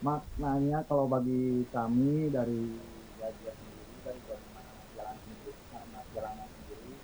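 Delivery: faint, distant phone voice; speaking away from the microphone; far from mic. Real, not acted. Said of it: "bisa tolong didekatkan mikrofonnya".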